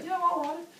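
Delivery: high put-on character voice; imitated agreeable reply. Teacher voicing Mark for the class, imitated agreeable reply.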